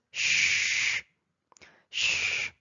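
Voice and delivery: long hushing sh with no vowel; sustained voiceless sh, twice; SH sound. Shown as a demonstration. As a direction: hold sh as a pure hiss. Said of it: "ça se prononce".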